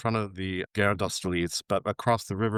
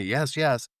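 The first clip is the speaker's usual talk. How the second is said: raised, higher-pitched insistent yell; mock scream; 6 semitones higher than usual. Imitating a metro inspector screaming at her, insistent. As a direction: imitate someone yelling, at half strength. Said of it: "screaming at me".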